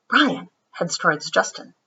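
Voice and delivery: ordinary delivery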